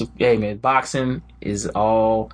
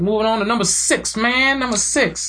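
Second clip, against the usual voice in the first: hyped announcer; 9 semitones higher than usual. Acted. Hyping the countdown ranking.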